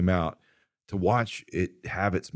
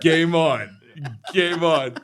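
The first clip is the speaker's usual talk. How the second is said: raised, louder playful dare; higher-pitched, punchy imitation of a rival's challenge; mock challenge; 7 semitones higher, 4 dB louder, much slower than usual. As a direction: throw down the gauntlet, playfully. Acted challenge in retold story.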